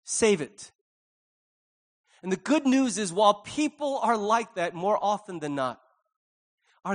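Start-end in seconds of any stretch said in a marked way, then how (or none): 0.0-0.7 curt refusal. Retold or imitated. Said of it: "they say".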